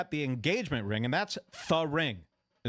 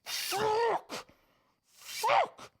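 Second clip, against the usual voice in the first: squeaky, much higher strangled cries; stifled laugh at a funeral. Retold or imitated. At a funeral where everyone sobs, he fights a high strangled laugh. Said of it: "and I was going".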